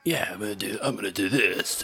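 trying on a disguised character voice; lightly altered, put-on timbre. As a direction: feel out a made-up hero voice. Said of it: "Do you think I should do a voice?".

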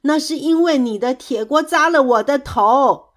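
loud, high comeback; loud, heated retort; defiant shout. A narrator's reading. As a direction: fire back without giving in. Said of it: "跳跳也不甘示弱".

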